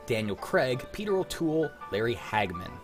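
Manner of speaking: ordinary talk, nothing marked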